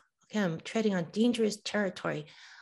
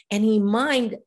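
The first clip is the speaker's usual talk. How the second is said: ordinary delivery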